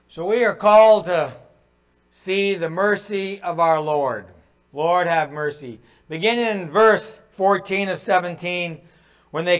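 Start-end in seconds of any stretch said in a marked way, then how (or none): none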